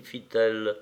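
usual speaking voice

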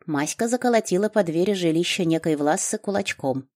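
plain reading voice

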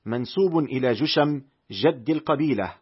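ordinary delivery

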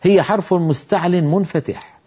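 ordinary delivery

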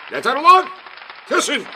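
high, loud bellowed order; furious shouting. A narrator's reading. Prime minister orders applauders to stop, shouting. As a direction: yell at the crowd to stop. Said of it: "diye bağırdı".

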